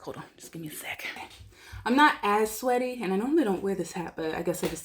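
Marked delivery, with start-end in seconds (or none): 0.0-1.1 whisper. Real, not acted. Whispered aside while setting up.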